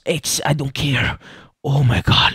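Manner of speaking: ordinary delivery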